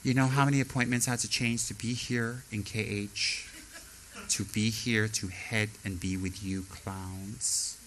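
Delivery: haughty member. Retold or imitated. Arrogant member arriving.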